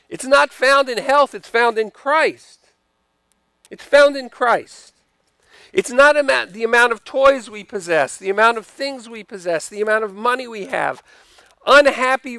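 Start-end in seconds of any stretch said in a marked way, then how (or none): 0.1-7.6 preaching. Real, not acted. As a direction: press each word home to the congregation. Preaching at full force.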